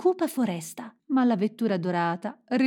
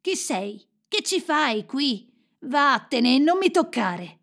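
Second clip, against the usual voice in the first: cold, rejecting character voice; unwelcoming, pitch climbing; hostile boy; 4 semitones higher, slower than usual. A narrator's reading. Frozen-hearted Kai rejects Gerda.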